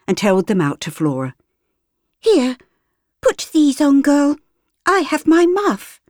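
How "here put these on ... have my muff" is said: put-on high, eager little girl; a kind child offering her mittens; young girl. Rich girl offers the street girl mittens, high.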